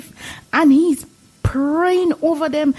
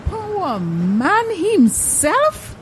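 surprised; 3 semitones higher, 3 dB quieter, slower than usual. Acted. Stunned by the announcement.

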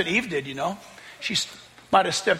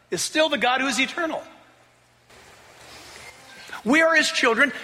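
ordinary delivery

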